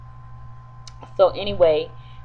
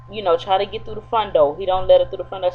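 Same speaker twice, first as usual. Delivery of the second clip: normal talking voice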